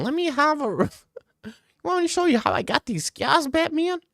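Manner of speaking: hammed-up bad Joker; Heath Ledger Joker. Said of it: "my Heath Ledger Joker is freaking amazing".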